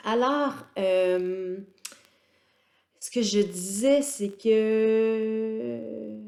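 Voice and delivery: very slow, calm delivery; slow motion. Real, not acted. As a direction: stretch every word out slowly. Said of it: "je parle au ralenti".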